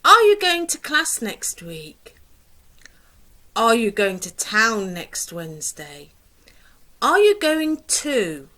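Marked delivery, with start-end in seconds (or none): 0.0-2.1 lively model question. Shown as a demonstration. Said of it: "What about those questions?". 7.0-8.5 bright model question. Shown as a demonstration. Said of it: "What about those questions?".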